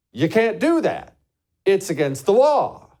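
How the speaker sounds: emphatic imitation of a court; mock stern ruling. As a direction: lay down the law, high and forceful. Voices the Supreme Court's firm ruling.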